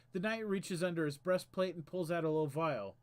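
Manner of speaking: usual speaking voice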